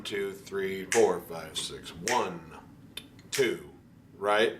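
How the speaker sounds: ordinary delivery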